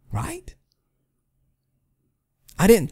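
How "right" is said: sarcastic whisper. Real, not acted. Sarcastic tag to rhetorical question.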